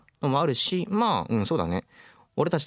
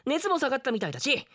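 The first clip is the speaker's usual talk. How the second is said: higher, eager call to adventure; excited boy; 13 semitones higher than usual. Acted. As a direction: pitch the next trip with glee. Boy eager for next trip.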